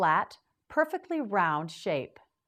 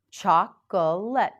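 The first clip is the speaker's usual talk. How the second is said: Chocolate drawn out slowly and louder, every syllable clear; slow model word; 7 dB louder than usual. Shown as a demonstration. Teacher modeling the word for the class, slow and loud. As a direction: stretch out Chocolate so learners catch each part. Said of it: "Now you say it".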